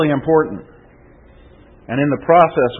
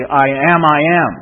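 "I am" struck loudly, then struck once more; emphatic; 2 semitones higher, 5 dB louder than usual. Shown as a demonstration. Preacher explaining the Greek to the congregation, emphatic. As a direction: hammer both "I am"s with force. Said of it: "which is an emphatic".

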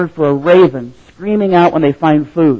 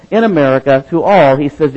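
ordinary delivery

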